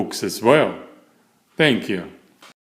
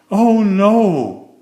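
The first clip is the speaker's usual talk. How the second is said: high, loud gasp of horror; gasped dismay; 10 semitones higher, 9 dB louder than usual. Acted. Mister Fussy finding the flooded bathroom, gasping in dismay. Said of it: "he gasped".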